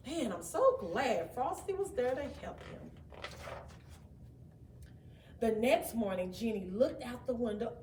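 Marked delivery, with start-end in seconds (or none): none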